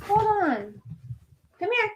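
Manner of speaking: high, soft coaxing call; coaxing pet voice. Real, not acted. Streamer coaxing the dog back, high soft baby talk. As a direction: coax the dog back gently.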